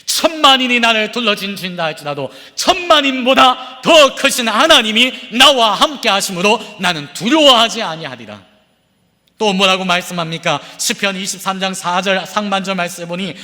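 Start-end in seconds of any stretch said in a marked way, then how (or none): 0.1-8.5 climactic preaching. Acted. Climactic declaration. (당당하고 힘찬 선포)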